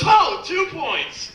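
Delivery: high, slow, delighted exclamation; gleeful cry. Acted. Landlord cheering as the body hits the dumpster, gleeful high cry. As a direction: crow it out like he just sank a shot. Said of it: "and goes".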